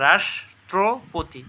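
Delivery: "রাষ্ট্রপতি" slowly as রাষ্-ট্রো-পোতি; syllable-by-syllable pronunciation. Shown as a demonstration. Teacher quizzing students, "রাষ্ট্রপতি" slow and split. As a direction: break "রাষ্ট্রপতি" into clear slow pieces. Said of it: "কী হবে".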